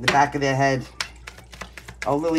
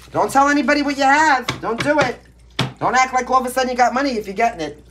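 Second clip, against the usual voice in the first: louder, higher insistent scolding; stern warning; 7 semitones higher, 4 dB louder than usual. Real, not acted. Reader warning the signs to hide their money, loud scolding. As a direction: lay down the law to the viewers. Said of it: "all the screaming at you that I did".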